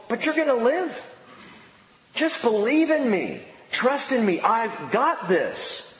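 like Jesus comforting a grieving friend; reassuring. Acted.

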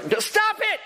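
high interrupting holler; exasperated therapist, very high-pitched; retold yell. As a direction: cut her off with a yell. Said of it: "he just yells in her face".

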